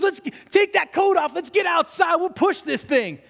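high, loud pep-up; rallying. Retold or imitated. Brent urging everyone out into the rain, high and eager. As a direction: fire up your friends to push. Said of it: "He says".

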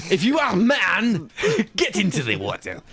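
grand French instructor challenge, half laughing; French accent. Acted. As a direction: taunt in a mock French accent. Playing the French canoe man.

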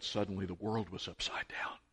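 hushed wonder, under the breath; breathless whispered awe. Retold or imitated. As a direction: whisper it like a secret miracle. Retelling a moving moment.

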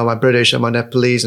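ordinary talk, nothing marked

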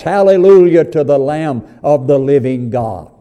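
rising, loud preacher cadence; fervent sermon delivery; preaching. Acted. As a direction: preach it out to the congregation. Preacher at full force.

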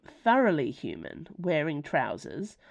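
plain reading voice

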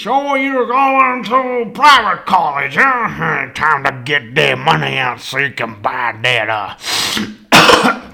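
drawling mock sneer at private college; hammed-up know-it-all doubter; jeering caricature. Mimicking what everybody thinks, jeering.

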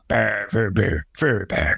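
rolled growling brr noises in a demon rasp; mocking demon growl sounds. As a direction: imitate a cliché demon voice with rolled brrs. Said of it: "every single voice from a demon is like".

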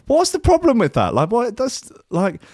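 high-pitched baffled objection; indignant protest. Real, not acted. Englishman defending driving on the left, indignant protest. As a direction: pitch shoots up in disbelief.

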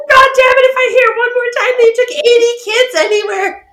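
hammed-up fed-up shout; exasperated outburst. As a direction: friends sick of hearing it, yelling in fun. Friends fed up with him.

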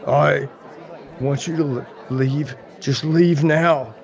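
low, slow, heavy warning; drunk man's warning. Acted. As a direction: tell them to leave, slowly and low. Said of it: "and the man goes".

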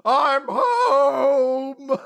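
high, loud demon bellow; demon's arrival. Retold or imitated. Host voicing the demon monster showing up, hammy bellow. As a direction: stretch it into a theatrical roar. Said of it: "and he's like".

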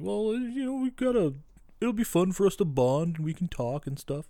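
meek, stumbling imitation of a father; imitated awkward dad. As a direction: tentatively plead for some bonding, half-mocking. Retold father pleading with kid.

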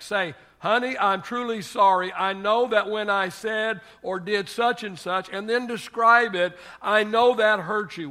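loud, high, emphatic preacher delivery; forceful preaching. Acted. Preacher urging husbands to own their mistakes, forceful. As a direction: drive it home from the pulpit.

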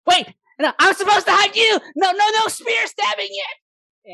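high, loud pleading gnome voice; squeaky panicked objection; alarmed gnome. Acted. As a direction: protest frantically as a little gnome under attack. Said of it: "And the gnome's like".

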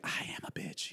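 mock whisper of a guilty confession; whispered sister imitation. Imitating his sister listening to the podcast, mock whisper. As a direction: breathe it out like the sister admitting fault. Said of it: "was just like".

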